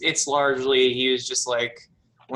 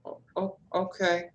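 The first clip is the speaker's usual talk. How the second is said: high, soft, hesitant; meek daughter; 8 semitones higher, 4 dB quieter than usual. Acted. Daughter takes toy as told, meekly. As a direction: accept timidly, softer and pitched up.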